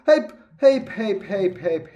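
high, loud hop cries; excited hops. Real, not acted. Gamer cheering himself across parkour blocks, high excited calls. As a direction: call out each jump with glee.

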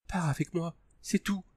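tearful woman whispering; whispered. A narrator's reading. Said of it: "chuchota-t-elle".

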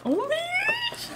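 very high, slow squeal of delight; thrilled, stretched-out high squeak; excited squeal. Real, not acted. Thrilled on seeing the toys.

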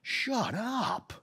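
fed up, cut her off; Paul fed up with the girl, snapping high; exasperated snap. Retold or imitated. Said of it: "he was like".

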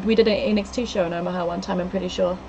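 ordinary delivery

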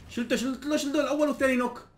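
high, thrilled shout of the kill; excited callout. Real, not acted. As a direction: crow over the double knock. (صيحة حماس عالية)

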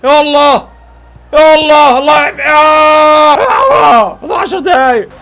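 loud, rising desperate cries; urgent pleading shout. Real, not acted. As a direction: beg the team to score. Urging team to score.

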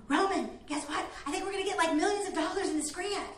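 hushed, laughing, giddy news; breathless excitement. Retold or imitated. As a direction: blurt out big news half-laughing, out of breath. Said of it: "running and excited".

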